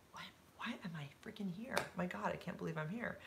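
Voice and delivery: hushed, disbelieving whisper to yourself; awed inner whisper. Retold or imitated. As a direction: whisper it like a private thought among bigwigs. Inner thought amid big executives.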